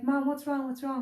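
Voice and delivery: put-on anxious kid calling up the stairs; worried child. Son finding his sick mother, mildly voiced worried child. Kids running up asking.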